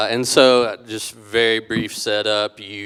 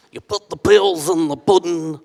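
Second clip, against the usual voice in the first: high, louder mock Cosby voice; Bill Cosby impression; 10 semitones higher, 10 dB louder than usual. Guest jokes mid-reading as a mock Cosby. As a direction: put on a goofy celebrity voice. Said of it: "Thinking about Bill Cosby".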